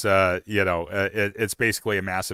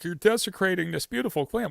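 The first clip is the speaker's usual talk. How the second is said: higher, indignant mock scolding; mock outrage; 10 semitones higher, 3 dB quieter than usual. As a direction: play the offended purist. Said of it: "these people are like".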